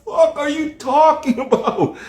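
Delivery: high, drawn-out disbelieving squeal; mock incredulity. Answering Miami defenders, high incredulous mock outburst. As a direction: stretch it out in laughing exasperation.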